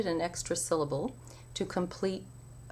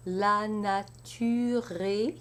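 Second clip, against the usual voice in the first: la nature est, drawn out slowly; slow recitation; 2 semitones higher, much slower than usual. Shown as a demonstration. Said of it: "for the first hémistiche".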